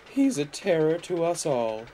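high, weary complaint; sighing old car. A narrator's reading. Caroline telling Mavis about George, sighing. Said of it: "sighed Caroline".

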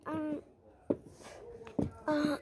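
ordinary talk, nothing marked

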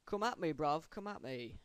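put-on loud cocky English accent taunt. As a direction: challenge them like a London lad. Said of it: "like my English accent".

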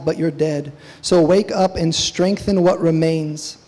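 loud, impassioned sermon delivery; preaching at full force. Acted. Pastor urging his congregation, preaching at full force. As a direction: preach it out with fire to the church.

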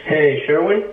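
a blunt phone opener that sounds too loud; loud blunt greeting. Real, not acted. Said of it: "do you hear your intro".

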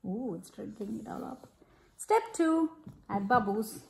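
plain reading voice

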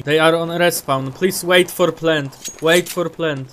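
slow English team call in a thick Polish accent; bad Polish-accented English. Real, not acted. Asking teammates in English to wait, bad Polish accent. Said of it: "mój akcent angielskiego jest równy gówna".

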